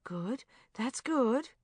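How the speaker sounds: slow soothing reassurance; calm her down, slowly and gently; reassuringly. Acted. Woman soothing a sobbing friend, slow and reassuring. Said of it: "I said reassuringly".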